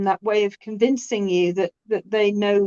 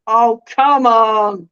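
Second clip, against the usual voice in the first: scoffing; 7 dB louder, much slower than usual. Retold or imitated. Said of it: "most of the British people were like".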